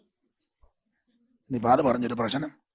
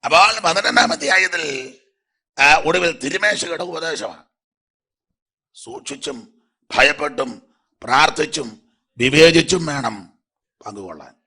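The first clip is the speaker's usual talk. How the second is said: preaching at full force; 3 semitones higher, 7 dB louder than usual. Real, not acted. Preacher proclaims spiritual gifts.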